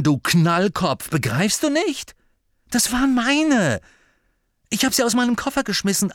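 put-on high, loud exasperated boy. A narrator's reading. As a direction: explain it loudly in disbelief. Harry exasperated explaining.

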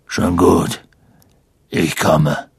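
low, rough big-man voice; rough, reluctant giant; gruff giant. Acted. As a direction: grumble agreement in a gruff voice. Sagte Hagrid.

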